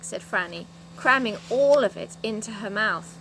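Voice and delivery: plain reading voice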